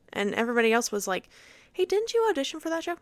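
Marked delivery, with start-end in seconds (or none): 1.7-3.0 high, quick, nosy question; curious peer asking. Retold or imitated. Other auditioner asking her about the show, curious and quick. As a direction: put on a higher voice, rattle off the question.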